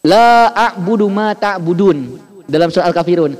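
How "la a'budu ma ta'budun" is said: La a'budu ma ta'budun chanted slowly with the la held; slow Quranic recitation of the line; recited verse. Shown as a demonstration. Teacher reciting Al-Kafirun slowly for the class. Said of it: "dalam masalah harokat".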